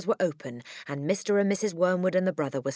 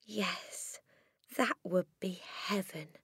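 soft whisper; hushed and longing; softly; 3 semitones higher, 9 dB quieter, slower than usual. A narrator's reading. Teacher whispers yes to Matilda softly. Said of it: "Miss Honey said softly".

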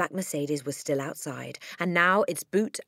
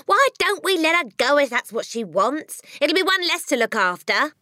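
mother voiced; 10 semitones higher, 7 dB louder than usual. A narrator's reading. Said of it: "the mother said".

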